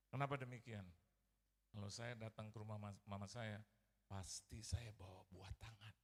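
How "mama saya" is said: normal talking voice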